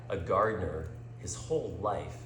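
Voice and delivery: ordinary delivery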